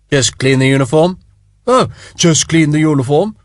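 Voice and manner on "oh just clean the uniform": lightly mocking copy of Sharp; mimicking. Spiers mockingly mimicking Sharp's words, a light mock. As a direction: parrot his words back, teasing. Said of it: "Lord Spiers mimicked him".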